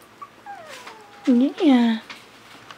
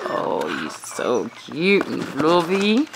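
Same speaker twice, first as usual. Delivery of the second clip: usual speaking voice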